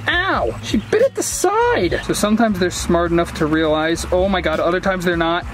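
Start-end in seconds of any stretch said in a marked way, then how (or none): 0.1-2.0 high startled cry; yelp, then high and slow. Real, not acted. Bitten by turtle.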